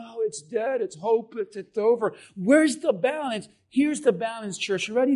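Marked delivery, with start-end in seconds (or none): 0.0-2.2 softer, lower, sighing defeat; a touch of hopeless moaning; mopey resignation. Retold or imitated. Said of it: "don't just live your life saying".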